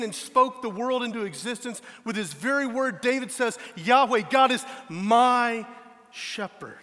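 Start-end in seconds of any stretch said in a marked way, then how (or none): 3.8-6.8 "Yahweh" called out loud and slow; stress on Yahweh. Real, not acted. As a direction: lean hard on "Yahweh, God". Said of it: "emphasis on God as shepherd".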